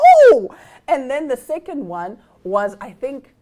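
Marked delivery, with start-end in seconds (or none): 0.1-0.5 sudden high loud "Oh!"; shocked gasp. Real, not acted. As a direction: yelp of shock. Shocked reaction again.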